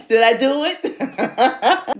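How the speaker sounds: loud, high, drawn-out excited question; giddy. Real, not acted. Asking viewers whether the accent worked, giddy. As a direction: squeal it through a laugh, slowly.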